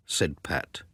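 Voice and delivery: plain reading voice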